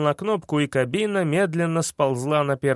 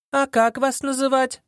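high, innocent question; playing dumb; 9 semitones higher than usual. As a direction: feign wide-eyed innocence. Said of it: "прикинулась дурочкой Саша".

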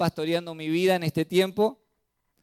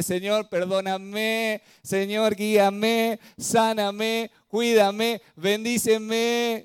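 pleading prayer; 8 semitones higher, 6 dB louder, much slower than usual. Retold or imitated. Acting out a sheep's prayer.

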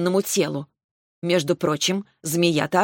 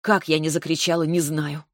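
plain reading voice

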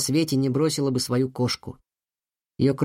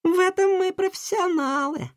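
put-on high girl voice, proud; young girls. A narrator's reading. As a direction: agree as proud little twins. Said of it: "Близнецы согласились".

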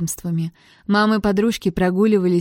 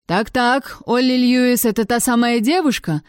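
higher-pitched, lightly voiced official; rector; 5 semitones higher than usual. A narrator's reading. Rector arrives and questions professor, lightly officious. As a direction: probe with a hint of pompous authority.

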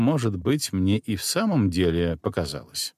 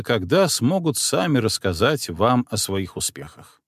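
ordinary delivery

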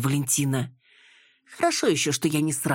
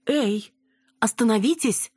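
high-pitched interjection; exclaimed protest to stop the bickering; exclaiming; 9 semitones higher than usual. A narrator's reading. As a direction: call a halt, pitch jumping up. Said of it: "воскликнула Цветкова".

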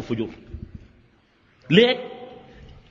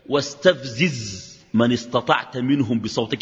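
recited verse; 6 semitones lower, slower than usual. Acted. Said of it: "قال لك منو؟".

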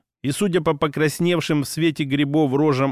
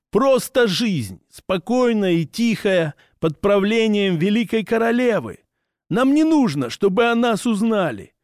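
proud orc voice; 6 semitones higher than usual. Acted. Orc explains the sentence.

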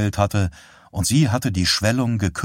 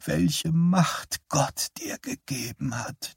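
put-on high, slow whisper of an old woman; 9 semitones higher, 5 dB quieter, slower than usual. Dying mother whispers of his God-given power. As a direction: breathe it out in awe. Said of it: "flüsterte sie".

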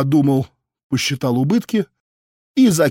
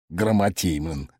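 scornful spit; 7 semitones lower, 6 dB quieter than usual. A narrator's reading. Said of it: "сплюнул Ромыч".

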